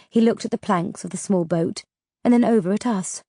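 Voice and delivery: ordinary delivery, nothing marked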